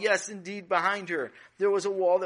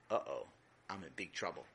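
low, soft uh-oh dread; worried; 12 semitones lower, 10 dB quieter than usual. Acted. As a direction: realize you're in trouble. Sammy realizes his mistake.

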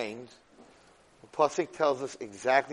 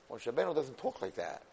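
normal talking voice

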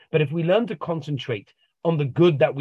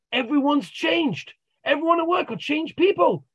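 amazed; 11 semitones higher than usual. Retold or imitated. Client back at the therapist, astonished and high.

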